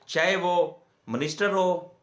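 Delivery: ordinary talk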